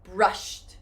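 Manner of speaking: "Brushed." as one syllable ending in t; demo brushed. Shown as a demonstration. Teacher models the right past tense, crisp t ending. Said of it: "like an airplane, you wanna go up".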